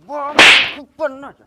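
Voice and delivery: put-on loud, slurred drunk shouting; town drunk. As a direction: bellow it like a staggering drunk. Drunk husband shouting at wife. (नशे में धुत चिल्लाता शराबी)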